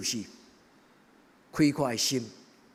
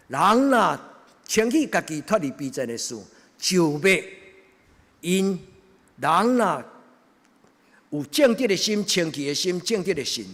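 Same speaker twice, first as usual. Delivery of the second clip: preaching; 6 semitones higher, 8 dB louder than usual. Acted. Preaching at full force.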